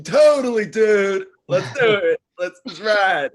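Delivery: loud, high California surfer dude; California surfer accent. Pretend. An actor showing off his Poseidon role in a California surfer voice. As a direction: shout it like a stoked California surfer. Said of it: "impersonating the Cali, like, West Coast personality".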